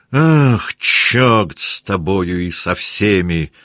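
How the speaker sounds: loud cry, then a slow furious curse; angry outburst. Acted. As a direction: fling the curse after him. Denisov curses as Rostov leaves.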